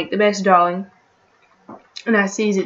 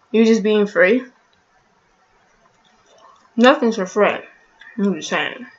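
chewing food; talking between bites; mouth full; 5 dB louder than usual. Real, not acted. Seen 1: Holds pizza slice, mouth working as if chewing while talking.

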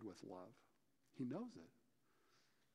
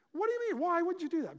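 much higher and louder indignant cry; retold furious outburst; 8 dB louder, faster than usual. Classmate demands why he won't tell, in a high, loud outraged cry. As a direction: push the pitch way up in outrage. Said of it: "And she got mad".

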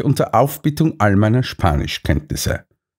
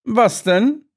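baffled Holmes, pitched much higher; clueless question, raised voice; Holmes puzzled; 12 semitones higher than usual. A narrator's reading. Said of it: "verständnisloses Gesicht". (Holmes verständnislos, deutlich höher)

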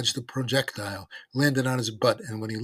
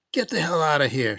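raised, higher voice; angry shout; 5 semitones higher, 6 dB louder than usual. A narrator's reading. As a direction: shout it to chase him off, not full volume. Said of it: "shouted Mick".

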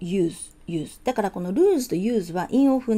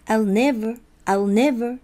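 "never" punched loud; stress on NEVER; 2 semitones higher, 5 dB louder, slower than usual. Shown as a demonstration. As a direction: hit "never" hard, "I'll" lighter. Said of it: "never強いですね". (「never」を強く)

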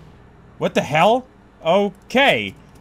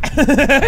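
high burst of laughter; quick delighted cackle; gleeful laugh; 6 semitones higher, 3 dB louder than usual. Real, not acted. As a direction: crack up at a foe's pain. Laughs at enemy being hurt.

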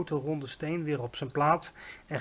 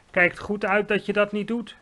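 warning; 6 semitones higher, 6 dB louder than usual. A narrator's reading. Giant warns girl in his ear.

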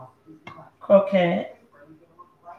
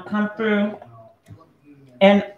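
talking while chewing; words round a bite of food; mouth full; slower than usual. Real, not acted. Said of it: "sorry, I'm chewing".

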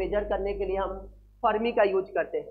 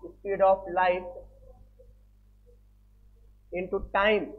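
slow dictation; much slower than usual. Shown as a demonstration. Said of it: "तो light year एक distance है".

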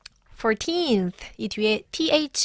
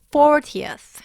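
"fortieth" with strong "for"; first-syllable stress; 5 semitones higher, 6 dB louder than usual. Shown as a demonstration. Teacher stating the rule, "fortieth" stressed on "for". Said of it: "첫 번째 음절에 강세가 오고".

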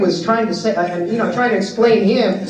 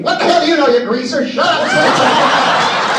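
raised, hammed-up angry outburst; imitating raging; 6 semitones higher, slower than usual. The writer snapping at the heckling kid, mock rage. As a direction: play the writer blowing up at the kid. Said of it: "Ray immediately begins raging".

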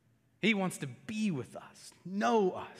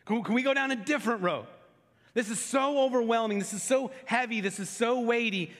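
pleading, pressing each "so" hard; heavy-hearted preacher's plea; impassioned preaching; 3 semitones higher than usual. Acted. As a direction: preach his overwhelmed plea with mounting weight. Preaching Jesus' anguished prayer.

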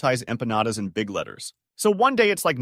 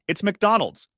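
high-pitched call to a friend; lightly hollered answer; calling across; 9 semitones higher, 3 dB louder, slower than usual. A narrator's reading. Shouted answer to distant friend.

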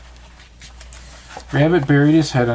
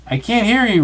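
high, loud call; high, raised cry; 10 semitones higher, 4 dB louder than usual. Acted. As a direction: call out over the cotton in his ears. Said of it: "Rabbit cried".

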